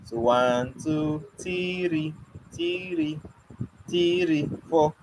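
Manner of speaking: "three" repeated, each one falling; explaining the trick count. Shown as a demonstration. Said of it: "the person used three to count two of these fingers".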